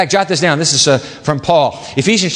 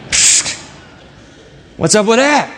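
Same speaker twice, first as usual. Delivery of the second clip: ordinary talk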